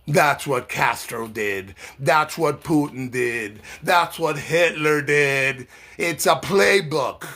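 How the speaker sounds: slow, weighted listing; slow and deliberate. Real, not acted. Naming dictators to viewers, slow and deliberate. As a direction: spell it out slowly, one name at a time.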